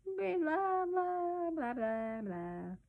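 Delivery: put-on sing-song baby babbling, sliding downward; gentle baby croon. A narrator's reading. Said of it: "crooning gently".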